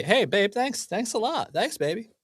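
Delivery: a hint of a careless husband; typical man. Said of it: "a typical man would just say".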